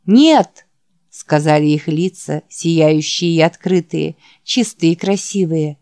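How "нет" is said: a loud group no, pitched up; shouted no. Acted. Boys in the hall answer the preacher with a loud no. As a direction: boys shout back as one.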